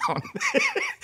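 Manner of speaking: high burst of real laughter; laughing. Real, not acted. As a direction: crack up at your own punchline. Pastor laughs at own joke.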